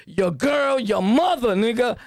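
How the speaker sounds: usual speaking voice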